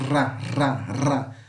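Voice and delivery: Ra with a rolled tongue-tip trill; isolated trilled syllable Ra; vibrato rr. Shown as a demonstration. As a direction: roll the R in each Ra. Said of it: "é um vibrato".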